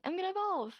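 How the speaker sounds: high, playful imitated declaration; cute announcement. Retelling friend dressed as Pikachu, cute mock announcement.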